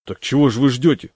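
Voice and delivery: can't believe they are waiting; surprised. Acted. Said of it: "удивился я".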